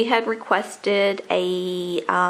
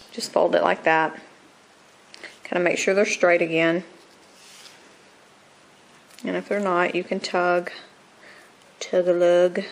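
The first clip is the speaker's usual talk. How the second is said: ordinary talk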